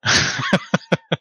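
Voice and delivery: high burst of laughter; real, high-pitched laughing fit. Real, not acted. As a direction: break up laughing at a slip-up. Players laugh at a typing mishap.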